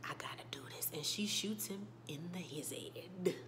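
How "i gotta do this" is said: whispered, much softer resolve; whispered self-talk before the shot. Retold or imitated. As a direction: breathe it to yourself before acting. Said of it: "closes her eyes like".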